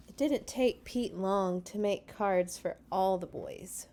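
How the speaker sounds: ordinary delivery, nothing marked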